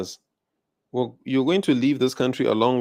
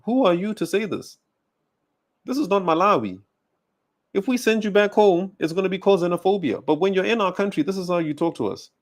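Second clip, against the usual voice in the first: higher-pitched, affronted confrontation; indignant challenge; 6 semitones higher than usual. Real, not acted. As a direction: challenge them with rising outrage in the pitch. Confronting the woman in clip.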